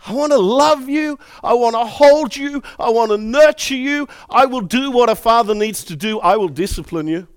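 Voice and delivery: loud, high impassioned preaching; preaching at full force. Acted. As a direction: preach it out with rising fervour.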